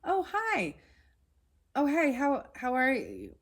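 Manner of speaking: high-pitched, delighted phone hello; happy greeting. Retold or imitated. Woman answering an old friend's unexpected late call, happy. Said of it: "I was very happy, like".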